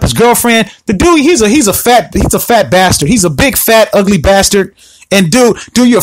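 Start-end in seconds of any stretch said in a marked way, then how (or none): none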